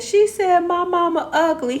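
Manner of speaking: high, loud mock-crying complaint; hammed-up tearful tattling; imitated crying child. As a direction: wail it like an upset little girl. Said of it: "And she crying, she said".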